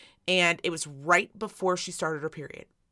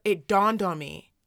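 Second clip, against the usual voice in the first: ordinary talk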